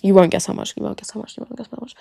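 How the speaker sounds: fading to a breathy whisper; whispering tease. Real, not acted. Teasing viewers, trailing off into a whisper.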